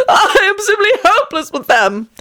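shrieky high, theatrical weeping; violent sobbing. A narrator's reading. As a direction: wail it through exaggerated sobs. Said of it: "he sobbed violently".

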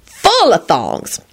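swooping, self-satisfied relish; enthusiastic. A narrator's reading. Lucretia boasting about her favorite, relishing it. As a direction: savour it, climbing high at the end. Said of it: "Lucretia announced enthusiastically".

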